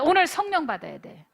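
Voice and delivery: ordinary delivery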